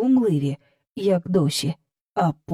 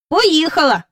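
Michael's reply; 8 semitones higher, 5 dB louder than usual. A narrator's reading. Michael answers.

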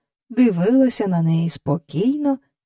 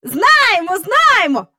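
put-on high, loud child blurting out; eager blurting; 12 semitones higher, 5 dB louder, slower than usual. A narrator's reading. Boy cutting in to greet the guest, eager blurt. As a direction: jump in eagerly. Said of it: "вихопився Майкл".

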